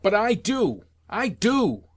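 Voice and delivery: high-pitched, indignant outburst; furious shout. A narrator's reading. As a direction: protest it furiously, pitch leaping up. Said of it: "I shouted in my fury".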